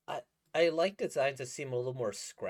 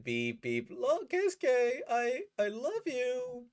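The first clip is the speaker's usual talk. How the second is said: much higher, slow lovey voice; lovestruck character; 13 semitones higher, 5 dB louder, slower than usual. Retold or imitated. Voicing the manga boy confessing love, high and slow. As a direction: swoon out the confession slowly.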